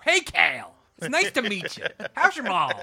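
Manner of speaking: loud, high hammed-up Baltimore accent; exaggerated Baltimore-local hello. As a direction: greet a hero in mock Baltimore. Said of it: "like I was from Baltimore".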